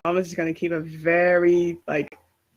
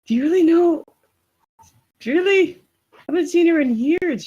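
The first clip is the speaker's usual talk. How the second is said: much higher, urgent disbelief; incredulous questioning; 11 semitones higher, 5 dB louder, faster than usual. Acted. Character asking after someone not seen in years, incredulous. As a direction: press the questions, pitched right up and quickening. Acted startled questioning about an absent woman.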